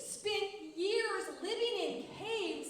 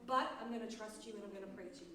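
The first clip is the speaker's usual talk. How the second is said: low, soft, quick self-reassurance; quiet resolve; 8 semitones lower, 7 dB quieter, faster than usual. Acted. Teacher as David settling back into trust, quiet resolve. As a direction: drop right down and say it softly, quickly. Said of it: "he's like".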